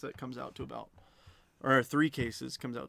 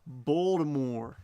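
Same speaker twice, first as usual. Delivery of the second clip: loud, drawn-out Baltimore accent; 8 dB louder, much slower than usual. Shown as a demonstration. Card seller having another go for viewers, exaggerated Baltimore accent. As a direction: stretch it into a slow, clumsy "Bawldamore" Baltimore accent. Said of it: "I can't do a good Baltimore accent".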